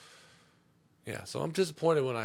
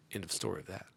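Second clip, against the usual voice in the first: ordinary delivery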